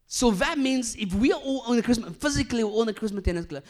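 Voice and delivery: loud, high, driving sermon delivery; full-force preaching. Acted. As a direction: preach it out to the whole hall. Preaching, fast.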